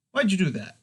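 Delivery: complain, stung; indignant. Acted. Hand just smacked.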